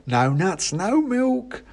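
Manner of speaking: high squeaky hawker voice; mouse seller. Acted. A mouse offers the narrator a pie, high and eager. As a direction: pitch it up like a cheery mouse.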